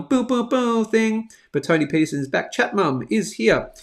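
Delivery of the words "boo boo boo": drawn-out, higher mock air horn; air horn imitation. Host recalling his DJ air horn bit, imitated horn. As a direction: hoot slowly like a DJ's air horn. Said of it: "done the air horn".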